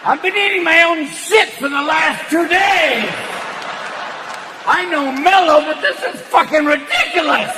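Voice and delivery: high, loud yelling cat; angry cat. Retold or imitated. Cat yelling at its stoned owner, loud and high. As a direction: scold the owner like a furious pet. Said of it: "your cat's going".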